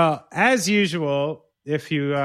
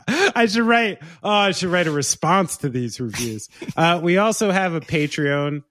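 ordinary talk

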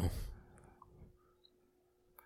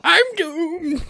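ominous high drawl. Acted. Mock doom.

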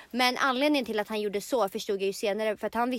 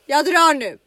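loud, higher exit line; acted defiant exit; 5 semitones higher, 12 dB louder, slower than usual. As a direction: announce a dramatic walkout. Acted threat to walk out. (högt, trotsigt besked)

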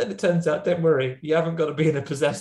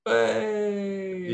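lightly voiced possessed demon, pitched higher; a hammed-up demon greeting, higher and odd-sounding; 7 semitones higher than usual. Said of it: "They'd probably go".

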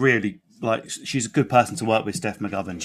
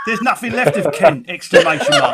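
ranting bigot voice; 6 semitones higher, 7 dB louder than usual. Pretend. Reading out mock bigoted tweet.

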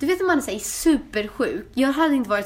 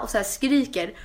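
ordinary delivery

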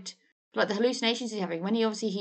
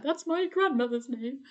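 offended voice; 6 semitones higher than usual. Pretend. Mock offended relative.

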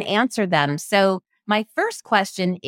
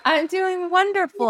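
sing-song delight; 12 semitones higher than usual. Real, not acted. Host answers with laugh, sing-song.

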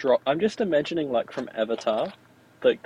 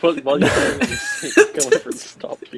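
much higher and louder, squealing; squeaky mock voice; 5 dB louder than usual. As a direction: squeak it out in comic disbelief. Mocking absurd scenario.

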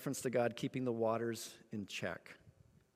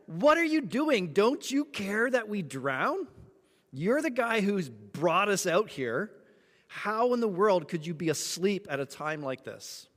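high, loud yell, easing slightly by the end; panicked screaming; 7 semitones higher, 7 dB louder, slower than usual. Acted. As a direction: scream at a sleeping man to rouse him. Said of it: "They start screaming at him".

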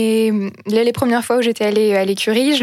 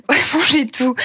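laughing; 4 semitones higher than usual. Real, not acted. Laughing while telling.

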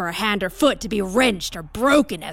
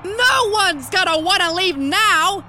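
excited shout; 5 semitones higher than usual. Acted. Commentator to the audience, thrilled by the death match, shouting. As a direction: sell the death match like a carnival barker.

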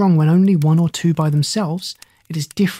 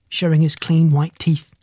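plain reading voice

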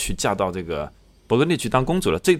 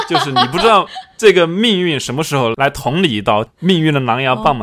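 laughing; 6 semitones higher, 9 dB louder than usual. Real, not acted. Joking about fate striking.

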